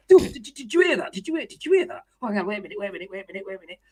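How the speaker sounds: high, panicky voice fading to a hush; mimicking Tony panicking after the swear; flustered Tony. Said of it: "Tony goes".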